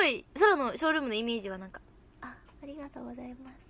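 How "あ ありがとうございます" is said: much softer, meek little thank-you; timid showroom thanks. Retold or imitated. Mimicking her own meek showroom self to the other members, very soft. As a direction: shrink into a shy, barely there thanks. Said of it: "すごいおとなしい".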